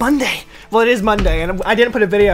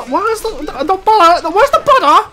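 mock British accent, high and loud on "butter"; 9 semitones higher, 4 dB louder than usual. Playing a party game and hamming up a British accent for her friend. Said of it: "My whole British accent is".

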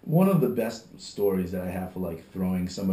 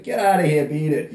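loud, raised-pitch mock order to leave; reenacted kick-out; 5 semitones higher, 8 dB louder than usual. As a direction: act out throwing a customer out, loudly.

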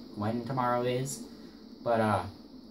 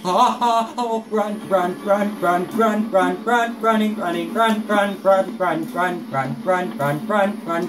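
panicked, loud, high chant of run; frantic urging; 8 semitones higher, 8 dB louder, slower than usual. Real, not acted. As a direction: yell it over and over in terror. Chased, urging the character to flee.

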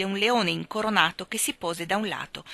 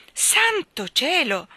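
drawn-out, high exclamation of astonishment; very surprised cry; 9 semitones higher, 6 dB louder, much slower than usual. A narrator's reading. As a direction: cry out slowly in sheer surprise. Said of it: "molto sorpreso".

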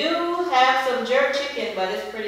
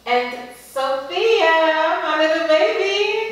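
higher, slow and fond; 7 semitones higher, 5 dB louder, slower than usual. Real, not acted. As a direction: coo over the little one. Introducing baby daughter.

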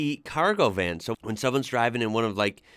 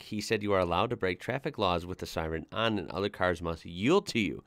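usual speaking voice

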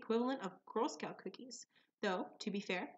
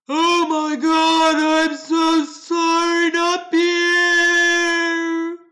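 very loud, slow, higher hammed-up cry; melodramatic wail; 7 semitones higher, 18 dB louder, much slower than usual. Customer feigns grief for Pierre, loud hammed-up wail. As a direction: wail it like a Shakespearean tragedian. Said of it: "like some Shakespearean actor".

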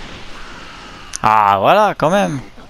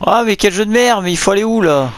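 louder, higher exasperated complaint; annoyed outburst; 8 semitones higher, 4 dB louder than usual. Real, not acted. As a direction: vent at the stupid game. Frustrated gamer, lost in level.

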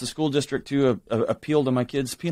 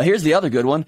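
ordinary delivery

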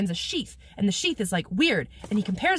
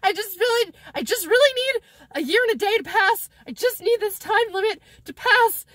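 high, loud strained plea; hammed-up panicked reassurance; mock frantic; 9 semitones higher, 4 dB louder than usual. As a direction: plead like you're barely holding it together. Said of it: "And he's like".

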